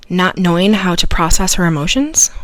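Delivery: ordinary talk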